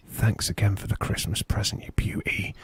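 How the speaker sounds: hushed, creepy whisper right into the ear; close-up whisper. Retold or imitated. Wrestler leaning close to his battered victim's ear, whispering. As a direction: whisper it low and intimate. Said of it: "he leant down really closely".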